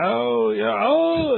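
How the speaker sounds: high, loud burst of sudden understanding; aha realisation. Retold or imitated. Learner finally hears the right pronunciation, delighted. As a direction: let the penny drop out loud. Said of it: "and then they go".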